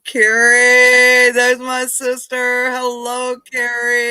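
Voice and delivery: loud, high, stretched-out hello; big drawn-out welcome; delighted greeting. Real, not acted. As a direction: holler happily to a sister. Greeting sister joining the live.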